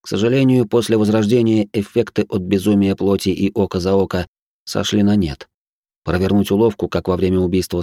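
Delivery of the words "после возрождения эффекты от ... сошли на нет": plain reading voice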